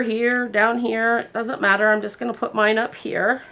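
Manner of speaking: ordinary talk, nothing marked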